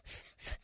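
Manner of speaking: "F" as a whispered voiceless f hiss; letter sound F. Shown as a demonstration. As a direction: breathe out "F" with no voice. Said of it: "elle fait toujours F". (son F chuchoté)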